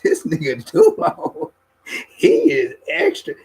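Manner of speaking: high, slow laughing exclaim; exasperated joke. Retold or imitated. Laughing about Dulo, high and slowed. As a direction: marvel in mock disbelief. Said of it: "I be like".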